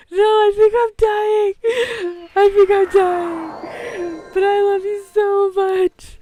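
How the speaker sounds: slow, high, loud sobbing; crying. Retold or imitated. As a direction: wail it through tears, dragging each word. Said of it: "and I'm crying".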